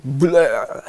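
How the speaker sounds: a hammed-up gross-out vomiting noise; mock vomit sound. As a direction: spew it out like a puking goblin. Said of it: "He's like".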